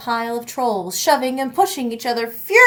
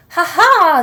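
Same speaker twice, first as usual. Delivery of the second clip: loud, high troll laugh bellowing out; 7 semitones higher, 7 dB louder than usual. Acted. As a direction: laugh like a big gloating troll. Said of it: "they bellowed together".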